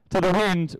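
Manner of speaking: normal talking voice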